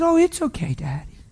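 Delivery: put-on high-pitched child reassuring her daddy; young daughter's reply. Daughter forgiving her apologetic dad, high little voice. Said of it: "she said".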